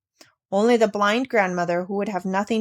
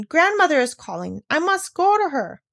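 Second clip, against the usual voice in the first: put-on higher child's cry out; child struggling free, crying out; 8 semitones higher than usual. A narrator's reading. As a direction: call out, pulling away.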